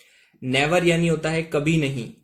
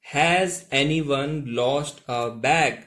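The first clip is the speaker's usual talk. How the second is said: slow read-out question; much slower than usual. Shown as a demonstration. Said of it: "अब देखिएगा दोस्तों क्या बोलता है वो".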